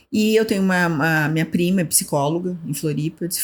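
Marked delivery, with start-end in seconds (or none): none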